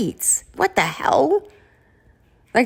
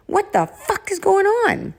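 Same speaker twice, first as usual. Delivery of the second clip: high, slow disbelief; stunned what the fuck; exasperated; 12 semitones higher than usual. Real, not acted. Exasperated at dating disasters.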